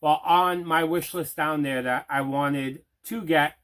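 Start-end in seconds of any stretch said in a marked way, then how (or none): none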